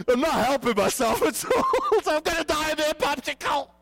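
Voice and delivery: high, loud, laughing panic; panicked laugh. Retold or imitated. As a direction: laugh through the fear of dying. Panicking while retelling crash fear.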